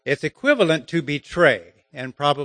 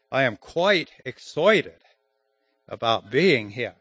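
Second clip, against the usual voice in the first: put-on Queen's English accent. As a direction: put on a British or Australian accent. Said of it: "proper English".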